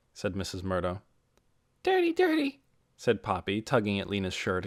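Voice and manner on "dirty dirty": put-on high, sing-song toddler voice; tiny girl echoing; little child voice. A narrator's reading. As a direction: chirp it like a small child. Little Poppy repeating.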